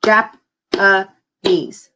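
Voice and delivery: Jap-a-nese split into three slow clapped beats; clapped syllables. Shown as a demonstration. Teacher clapping syllables for the class, slow and split. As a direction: clap out Japanese syllable by syllable. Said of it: "We could clap it out".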